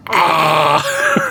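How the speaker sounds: drawn-out "ah" of a weird creature; alien duck cry. Retold or imitated. Streamer voicing a weird tree creature, alien duck cry. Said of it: "weird alien duck creature that's going".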